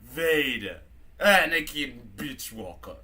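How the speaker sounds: put-on drunken British robot working up to outrage; drunk C-3PO. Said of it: "He's all like".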